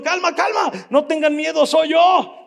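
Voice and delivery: high, loud call across the water. A narrator's reading. Jesus calling to the frightened disciples, loud high shout. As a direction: shout reassurance to the scared men. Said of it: "Jesús inmediatamente les gritó".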